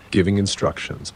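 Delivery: plain reading voice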